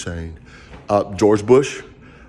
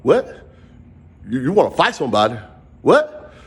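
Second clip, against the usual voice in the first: street confrontation. Retold or imitated. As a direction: get in his face, bruh. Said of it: "I started to".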